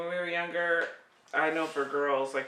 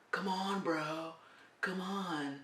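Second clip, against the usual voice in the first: softer, lightly mocked surfer kid; surfer-bro teen; 7 dB quieter, slower than usual. Kid calling mom bro, mild mock surfer voice. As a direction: plead like a teen bro, understated. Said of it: "they just sound like surfers".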